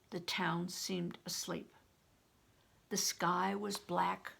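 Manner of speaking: plain reading voice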